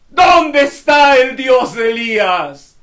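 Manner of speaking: high, loud, slow desperate call; anguished cry. Acted. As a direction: cry out to heaven in grief. Eliseo striking the Jordan in grief.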